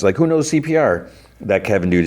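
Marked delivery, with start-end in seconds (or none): 0.2-1.1 high, urgent call out; mock cry for help. May calling to the bar patrons, mock urgent. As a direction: cry out to the room, pitched high. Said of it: "May cries out and asks".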